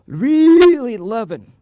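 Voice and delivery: loud drawn-out relish. Real, not acted. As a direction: stretch it out with gusto. Relishing the dog's enjoyment.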